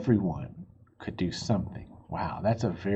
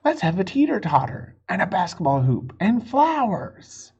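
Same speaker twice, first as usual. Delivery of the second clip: put-on eager kids; 7 semitones higher, 5 dB louder than usual. Kids' ideas voiced in story.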